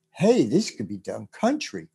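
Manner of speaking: ordinary talk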